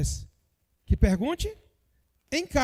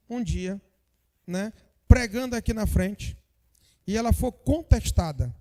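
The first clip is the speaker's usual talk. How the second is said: normal talking voice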